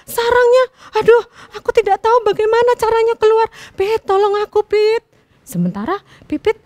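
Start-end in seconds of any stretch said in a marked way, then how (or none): 0.0-5.0 high, loud panicked begging; trapped bulbul shouting to the sparrow for help; desperate pleading. A narrator's reading. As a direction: beg frantically from inside the trap. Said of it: "dia langsung teriak".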